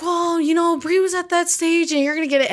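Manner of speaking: fatherly advice. Retold or imitated. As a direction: reassure her like a patient father. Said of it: "he was like".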